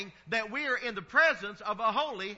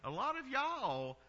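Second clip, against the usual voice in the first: Southern drawl; 4 semitones higher, 6 dB quieter than usual. Shown as a demonstration. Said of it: "we do draw things out a little bit".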